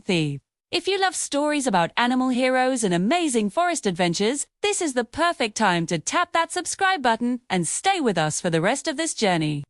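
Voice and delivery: ordinary delivery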